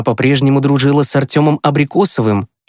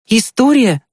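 put-on high boy's voice asking back; eager questioning; 11 semitones higher than usual. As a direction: ask back high, with interest. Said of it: "переспросил Тимкин". (заинтересованно переспросить высоким голосом)

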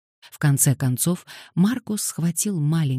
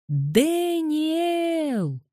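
very high, long drawn-out call; piercingly high wail, held back in volume; desperate wail; 14 semitones higher than usual. A narrator's reading. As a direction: stretch the name into a child's high wail. Girl keeps wailing after the boys.

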